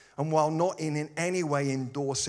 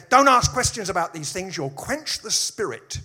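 loud, raised mock warning; mock scolding; 6 semitones higher, 9 dB louder than usual. Preacher mimicking people who forbid questions, mock scolding. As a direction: imitate a scolding objector, loud and high.

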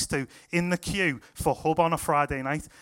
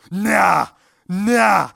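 loud, high, drawn-out cry of disbelief; amazed yelp; 3 semitones higher, 9 dB louder than usual. Retold or imitated. Healed lad testing his ankle, amazed yelp. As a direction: imitate a lad yelling in astonishment.

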